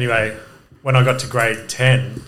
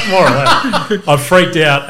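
laughing; 5 semitones higher, 5 dB louder, slower than usual. Real, not acted. Laughing while retelling panic.

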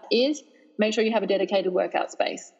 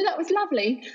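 put-on English accent, pitched higher; mock English accent; 9 semitones higher than usual. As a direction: ham up a posh Brit, laughing at yourself. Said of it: "the Australian trying to do that accent".

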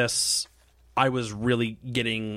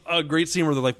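ordinary delivery

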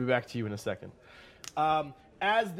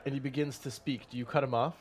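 normal talking voice

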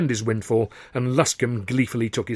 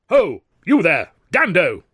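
loud, high, peremptory hail; ringing call; 6 semitones higher, 6 dB louder, slower than usual. Acted. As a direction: hail someone sharply down a corridor. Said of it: "a voice rang out".

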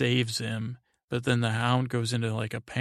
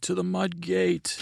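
higher, rallying cry; mock battle call; 7 semitones higher than usual. Recapping the siege, calls the soldiers' cry higher. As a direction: call it out to the troops.

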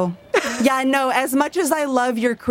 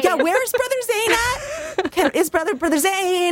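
mocking; 12 semitones higher than usual. Mocking the cult leader's name.

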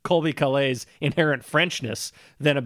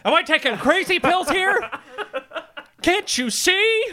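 high, incredulous plea; a high disbelieving plea; mock exasperation; 12 semitones higher than usual. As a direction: act baffled that nobody sees it.

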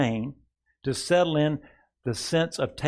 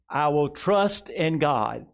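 slow, weighty words for the church to echo; slow declaration to repeat; 3 semitones higher, slower than usual. Shown as a demonstration. Pastor leading the congregation, slow and deliberate. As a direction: lay each word down slowly. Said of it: "Say this with me".

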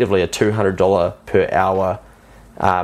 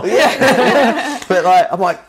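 high, loud laughing through the words; 14 semitones higher, 4 dB louder, much slower than usual. Real, not acted. Sharing the joke with the host, laughing.

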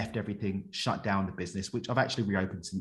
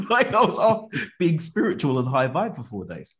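higher and louder, laughter spilling into the words; laughing in disbelief; 7 semitones higher, 6 dB louder than usual. Real, not acted. Guest tells host of his misfortune, laughing. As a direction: laugh at the irony of it.

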